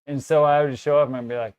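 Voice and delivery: usual speaking voice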